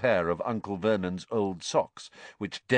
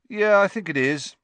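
off-hand; 11 semitones higher, 6 dB louder than usual. Acted. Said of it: "in an off-hand sort of way".

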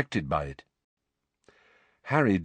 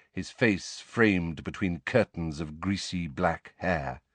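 plain reading voice

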